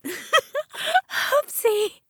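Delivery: high tipsy giggly oopsie; giddy. Acted. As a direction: squeal it out giggling. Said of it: "she giggled".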